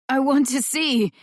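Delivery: pleading. A narrator's reading. Injured boy pleads with paramedic.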